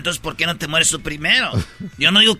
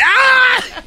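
startled yelp; 3 dB louder than usual. Pretend. Pretend jump-scare at friend.

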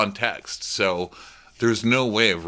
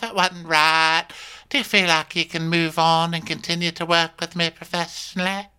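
pitched-up earnest woman voice; higher Natasha voice; 9 semitones higher than usual. Retold or imitated. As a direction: play Natasha, higher and sincere. Voicing Natasha reassuring Kyle.

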